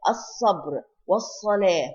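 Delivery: الصبر والصلاة with both Ls of the article dropped; silent lam. Shown as a demonstration. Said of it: "we do not pronounce the lam".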